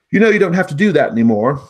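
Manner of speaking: high, loud, quick gnome, friendly; a chirpy gnome talking to a cat. Acted.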